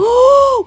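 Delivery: sharp high gasp of amazement; gasp, impressed; surprised gasp. Real, not acted. Amazed at firefighter calories.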